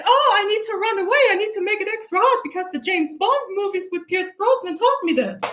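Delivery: mock girly voice. Mocking a girl copying Bond movie women, high and fast. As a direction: parody a girl playing Bond girl. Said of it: "you need to be like".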